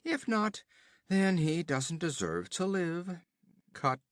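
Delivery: höher und lauter, prahlerisch spöttisch; höhnischer Räuberhauptmann. Acted. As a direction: ein Wüstenräuber verhöhnt den Gefangenen. Olgerd befiehlt.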